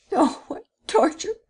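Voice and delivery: high anguished cry. Acted. Young man cries out in torment to the prince, high and anguished. Terrified refusal.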